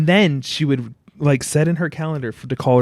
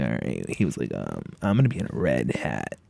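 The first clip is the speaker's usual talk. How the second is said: low creepy caller; 13 semitones lower, 7 dB quieter than usual. Retold or imitated. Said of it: "He's like".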